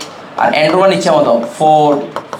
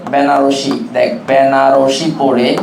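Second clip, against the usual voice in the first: very slow, lower, drawn-out phrases; slow dictation; 4 semitones lower, much slower than usual. Shown as a demonstration. Teacher giving students a mnemonic to learn, slow dictation. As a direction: dole it out phrase by phrase, stretching words.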